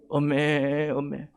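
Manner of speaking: softly drawn-out lamb bleat; lamb's bleat. Acted. Imitates a lost lamb's bleat.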